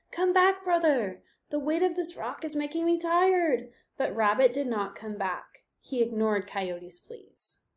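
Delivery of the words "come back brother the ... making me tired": shouted plea. A narrator's reading. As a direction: call him back, getting tired. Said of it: "Coyote shouted".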